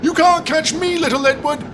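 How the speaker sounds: very high, singsong gloating taunt; taunting Gordon. Acted. Gordon taunting Edward in the chase, very high and gloating. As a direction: tease the little engine from ahead.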